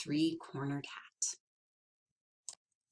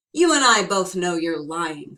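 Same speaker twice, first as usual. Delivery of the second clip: stern threat; 10 dB louder than usual. Acted. Tom threatens leprechaun.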